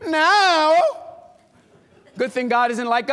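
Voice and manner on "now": sarcastic disbelief. Retold or imitated. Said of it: "And I was like".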